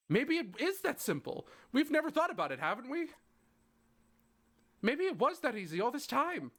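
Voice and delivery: high, rushed, pleading hope; cling to a last idea; desperate hope. Acted. Siffrin pleading with Loop, high desperate hope.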